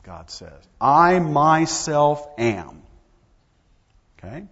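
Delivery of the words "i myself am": "I myself am" slow, loud and higher; profoundly emphatic. Shown as a demonstration. Preacher translating God's words to Moses, emphatic "I myself am". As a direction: weigh each word of "I myself am" forcefully. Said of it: "Profoundly emphatic".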